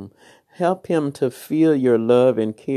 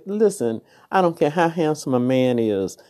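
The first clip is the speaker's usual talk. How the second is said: ordinary talk, nothing marked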